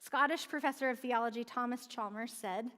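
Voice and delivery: ordinary delivery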